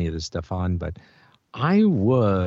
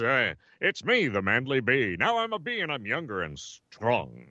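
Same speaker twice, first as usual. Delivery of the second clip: Manly Bee. Acted. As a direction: announce yourself as a newly young cartoon bee. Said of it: "I played a young version of my old man self".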